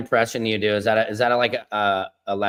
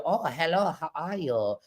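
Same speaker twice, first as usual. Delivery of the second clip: soft, higher put-on greeting; mock impression; 5 semitones higher, 5 dB quieter than usual. Shown as a demonstration. Comic demonstrating an impression, soft put-on voice. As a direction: say hello in a softer, higher character voice. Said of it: "it's funny if we do".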